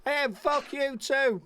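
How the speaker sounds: high, drawn-out imitated shout; mock shout. Neighbour answering his greeting, mock shout over. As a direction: call it across slowly and high, without real volume. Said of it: "she shouts over".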